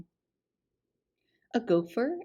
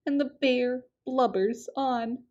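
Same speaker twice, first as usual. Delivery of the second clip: plain reading voice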